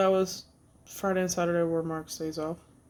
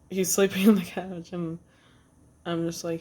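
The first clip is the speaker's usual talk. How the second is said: normal talking voice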